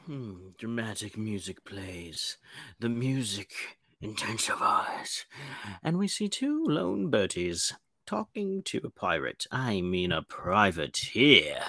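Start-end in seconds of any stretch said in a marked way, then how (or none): 0.1-9.4 mock dramatic narration. A narrator's reading.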